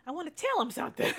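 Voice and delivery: fast, laughing I wanna tell him something. Real, not acted. Admitting she wants to call him, laughing fast. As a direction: laugh at your own urge.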